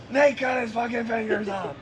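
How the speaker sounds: high, loud panicked blurt, imitating a scared friend; retold panicked shout. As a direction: burst through the door yelling in a panic. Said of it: "He's panicking".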